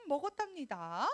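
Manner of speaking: ordinary talk, nothing marked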